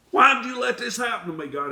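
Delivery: high, loud and fast accusation; sudden outburst. Acted. Believer accuses God in a fast, loud, high cry. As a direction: hurl it at God. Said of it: "a sudden outburst against God".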